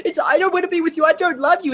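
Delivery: high, whiny-pleading imitation of a film heroine; high-pitched mock protest; mock lovers' protest. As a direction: act out a hammed-up romantic refusal. Acted lovers' quarrel from a film.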